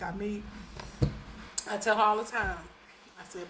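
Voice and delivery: ordinary delivery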